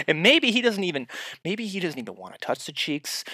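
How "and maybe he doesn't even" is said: ordinary talk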